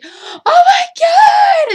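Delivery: drawn-out, very high mock scream; top-of-lungs scream. Fan re-enacts reaction to the show, screaming. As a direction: scream it at full lung power, re-enacting a fan's shock. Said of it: "just screaming to the top of my lungs".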